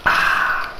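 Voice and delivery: breathy "ah" after a drink; refreshed sigh. Real, not acted. Reviewer pausing for water before a big scene, refreshed sigh. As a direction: let out a soft, voiceless sigh after a sip. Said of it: "quick sip of my water".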